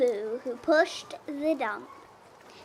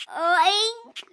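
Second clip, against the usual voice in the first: higher, louder piggy oink; 6 semitones higher, 6 dB louder than usual. A narrator's reading. Piggy greets Blue, higher oink. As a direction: snort out a pig's oink. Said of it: "said a piggy".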